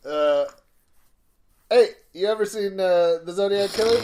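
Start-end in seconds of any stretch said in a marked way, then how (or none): 1.7-4.0 a hint of a Filipino accent, higher and louder; lightly voiced Filipino accent, pitched up and pushed louder; imitating Mike Adrales accent. Comedian trying a Filipino accent on his friend, half-hearted. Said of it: "let me see if I can do a Mike Adrales accent".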